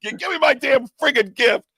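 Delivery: high, loud hammed-up demand; mock yelling. Man re-enacting his yelling at the woods over cookies and gift, mock yelling. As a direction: holler it high, sending up your own rant. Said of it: "Yelling out to the woods".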